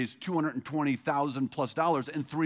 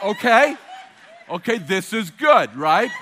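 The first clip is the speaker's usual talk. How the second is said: laughing mock reply; 7 dB louder, slower than usual. Husband's flustered reply, laughing.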